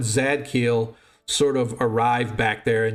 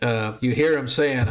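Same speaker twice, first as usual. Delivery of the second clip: ordinary talk, nothing marked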